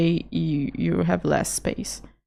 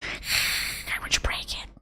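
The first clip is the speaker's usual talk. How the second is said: breathy whisper; 10 dB quieter than usual. Real, not acted. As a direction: whisper it with longing. Sigh then whisper.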